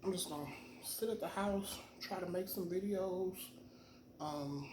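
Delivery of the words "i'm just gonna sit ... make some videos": normal talking voice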